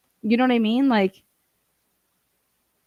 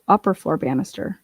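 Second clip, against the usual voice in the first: ordinary talk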